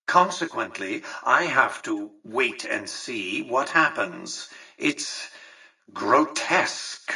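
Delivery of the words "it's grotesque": slow, drawn-out disgust; disdainful. Acted. Boss left waiting on events, disdainful. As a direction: stretch the word out with disdain. Voicing contempt at being useless.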